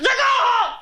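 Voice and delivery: loud shouted order; loud retold shout; mock shout. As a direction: bark it at her.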